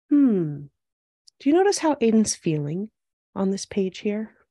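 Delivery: ordinary delivery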